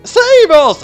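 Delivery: very high, loud cry for help; panicked shout. A narrator's reading. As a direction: scream it like terrified crowds.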